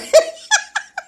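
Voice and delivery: a high, bright burst of laughter. Real, not acted. Host laughing at a viewer's comment, high burst of laughter.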